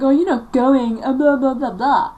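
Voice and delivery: high, loud imitation of a nagging mother; mock scolding mother. Mother forbids daughter's barbecue outing, mock scolding.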